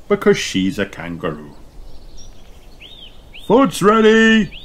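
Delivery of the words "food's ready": high, loud sing-out; slow, raised call to the table. A narrator's reading. Mister Kangaroo summons everyone to eat, calling out. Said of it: "calls Mister Kangaroo".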